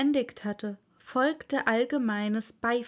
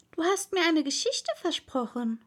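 lightly voiced, higher girl's voice; Alice; 6 semitones higher than usual. A narrator's reading. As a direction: remind them in a higher voice. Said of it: "sagte Alice".

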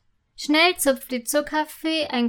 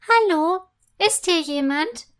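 high, loud hello, then a surprised question; astonished high voice; 5 semitones higher, 4 dB louder than usual. A narrator's reading. Unseen singer answering a cry for help, astonished. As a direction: call out in mild astonishment. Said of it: "fragt die hohe Stimme erstaunt".

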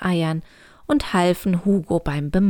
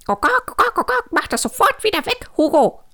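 high, indignant clucking hen; cackling hen; 13 semitones higher, 5 dB louder, faster than usual. A narrator's reading. Angry hen orders Hugo to undo it, cackling. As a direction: squawk it like an outraged hen. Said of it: "gackerte Hanni".

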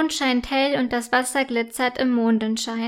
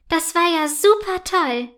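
higher, thrilled little foal; excited; 5 semitones higher than usual. A narrator's reading. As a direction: gush with delight.

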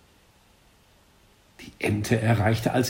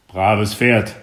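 louder, different voice for the teacher; 6 dB louder than usual. Acted. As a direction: praise like a gruff coach. Teacher praises horse.

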